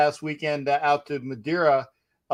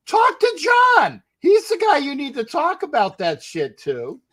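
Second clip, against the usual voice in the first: emphatic shout; 12 semitones higher, 4 dB louder than usual. Retold or imitated. Retelling brushing off flight attendant.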